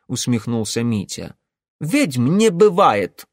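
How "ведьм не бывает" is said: slow, loud stubborn denial; stubborn insistence. Acted. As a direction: dig in stubbornly. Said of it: "упрямо повторил Ингвар".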